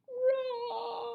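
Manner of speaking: high, crying roar of a little bear. A narrator's reading. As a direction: let the cub roar out even bigger. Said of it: "And an even louder".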